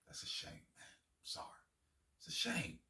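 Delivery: near-whispered, rueful aside; hushed mock condolence. Real, not acted. Host commiserating with Brooklyn over its failed superteam, hushed. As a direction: let it sink almost to a whisper.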